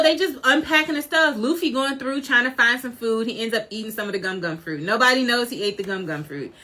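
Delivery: normal talking voice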